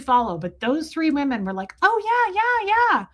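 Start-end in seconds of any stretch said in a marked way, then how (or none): 1.8-3.1 much higher, gushing yeahs; eager high yeahs. Retold or imitated. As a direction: gush agreement way up high. Women eagerly agreeing.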